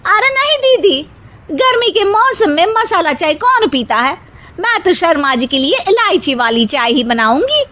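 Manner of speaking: put-on high chirpy young woman voice, ending in a laugh; a cheerful bahu disagreeing; sweet young bahu. A narrator's reading. Middle bahu argues with her elder sister-in-law, high chirpy voice.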